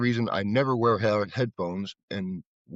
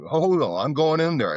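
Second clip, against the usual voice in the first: louder, higher, determined; firm, louder insistence; 7 semitones higher, 5 dB louder than usual. Retold or imitated. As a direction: insist you're going in anyway. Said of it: "I said".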